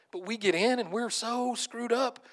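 high, slow, loud disbelief; incredulous wonder. Retold or imitated. Preacher amazed at being grafted in, incredulous. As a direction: draw it out in amazement.

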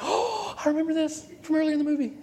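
high-pitched gasping excitement; fast, way-up mock awe; thrilled recognition. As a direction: imitate an audience member squealing in recognition, quickly. Audience thrilled at crane kick.

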